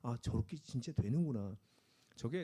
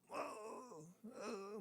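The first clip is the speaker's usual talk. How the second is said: straining under weight; 6 semitones higher, 12 dB quieter, much slower than usual. Retold or imitated. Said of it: "그 이상을 드는데 힘들거든요".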